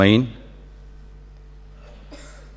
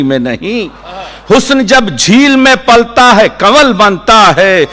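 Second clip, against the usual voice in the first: high, rhythmic declamation dropping at the final phrase; poetry recitation; 13 semitones higher, 8 dB louder than usual. Acted. Poet carried on by the audience's wah, declaiming his nazm. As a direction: drive the refrain out in a sustained cadence.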